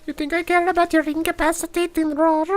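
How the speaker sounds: very high, squeaky taunting voice; squeaky mock voice. Scoffing at the enemy's roar, in a very high squeaky voice. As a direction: squeak out your disdain like a cartoon mouse.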